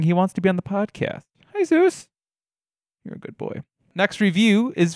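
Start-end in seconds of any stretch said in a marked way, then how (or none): 1.5-2.1 very high sing-song hello to the dog; high-pitched pet voice greeting Zeus. Real, not acted. 3.1-3.6 whispered, much softer praise for the dog. Real, not acted. Talking to the dog.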